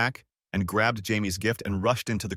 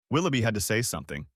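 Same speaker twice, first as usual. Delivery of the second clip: ordinary delivery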